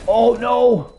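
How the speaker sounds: dismayed yelp. Real, not acted. Killed suddenly in game.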